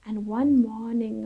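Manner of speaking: plain reading voice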